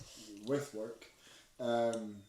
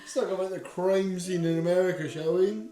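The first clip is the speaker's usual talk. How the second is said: loud, high sarcastic host voice; mock presenter; 6 semitones higher, 10 dB louder than usual. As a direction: announce it like a TV host. Mock presenter after gloomy turn.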